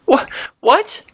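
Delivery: put-on very high, stammering child voice; crying girl. A narrator's reading. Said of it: "the girl cried".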